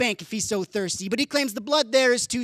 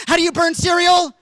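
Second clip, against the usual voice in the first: loud, high incredulous question; exasperated, raising pitch and volume; exasperated outburst; 7 semitones higher, 6 dB louder than usual. Acted. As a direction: burst out in comic disbelief. Baffled outburst at Depression's cooking.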